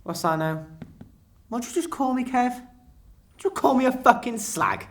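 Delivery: high, shrill yell, not clean but acted; mock furious yell. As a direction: shriek the insult back. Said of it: "I goes".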